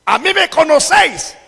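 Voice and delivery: high, loud shout, slowing at the end. Acted. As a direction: proclaim it at full force. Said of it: "alzó la voz y dijo".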